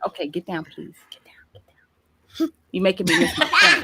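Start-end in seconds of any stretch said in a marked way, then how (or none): 0.1-2.5 quiet parental order, off to the side; hushed aside. Real, not acted. Hushing a child aside mid-interview.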